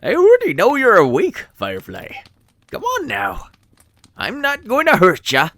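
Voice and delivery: put-on high, loud friendly old man. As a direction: coax a sleeper gently as an old man. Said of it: "someone chuckled softly".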